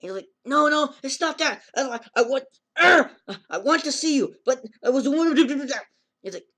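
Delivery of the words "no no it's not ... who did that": higher, louder panicked denial; mock panicked protest, loud and high; flustered protest. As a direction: blurt it out in a rush to explain. Boy protesting loudly.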